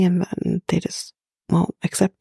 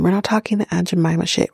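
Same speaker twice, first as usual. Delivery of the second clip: ordinary talk, nothing marked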